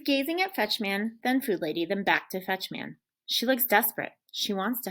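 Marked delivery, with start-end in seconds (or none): none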